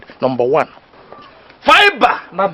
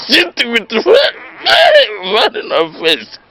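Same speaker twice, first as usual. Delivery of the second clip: laughing drawl; 11 semitones higher, much slower than usual. Acted. Comic teacher acting out the face.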